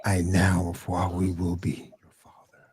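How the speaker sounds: whispered villain line; Vader whisper. Acted. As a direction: breathe it out like Vader. Vader quote again.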